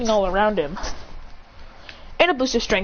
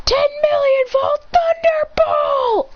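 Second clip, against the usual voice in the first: high, slow attack shout; battle cry; 3 dB louder, much slower than usual. Acted. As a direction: belt the move name. Hero calls ultimate attack.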